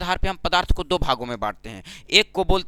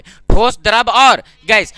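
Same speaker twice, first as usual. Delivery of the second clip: emphatic drilled list; 7 dB louder, much slower than usual. Shown as a demonstration. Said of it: "teacher stresses the three states".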